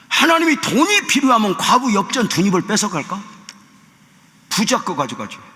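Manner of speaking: high-pitched rhetorical challenge; fervent sermon question, pitched high; impassioned preaching. Real, not acted. Preaching at full force.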